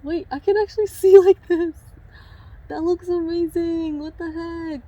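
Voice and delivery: high, laughing amazement; excited disbelief. Real, not acted. Seeing the finished cut, high excited disbelief. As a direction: marvel out loud, breaking into a laugh. Amazed at her own haircut.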